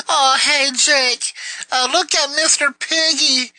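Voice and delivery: high, silly mock girl voice; imitating a childish girl; mocking girly voice. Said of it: "it was just like".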